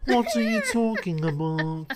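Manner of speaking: Annalee Ashford in Kinky Boots. Shown as a demonstration. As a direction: drag it out in a silly high accent. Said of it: "when someone's doing a British accent".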